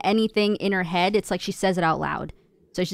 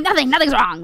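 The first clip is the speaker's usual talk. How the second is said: loud, high, insistent retort; raised-voice Italian-family comeback; aggressive reply; 6 semitones higher, 7 dB louder than usual. Shown as a demonstration. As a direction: fire back loudly at mom. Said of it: "I have to respond, like, that way".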